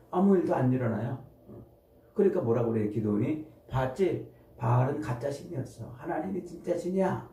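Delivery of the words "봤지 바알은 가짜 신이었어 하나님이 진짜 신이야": usual speaking voice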